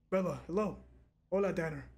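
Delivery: usual speaking voice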